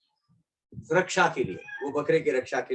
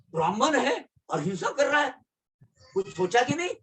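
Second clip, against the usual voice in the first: high, rising rebuke; mock reproach; 8 semitones higher, faster than usual. Baba asking Kaka if he thought at all, mock reproach. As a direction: question him in pitched-up disbelief. Baba's acted reproach, translated.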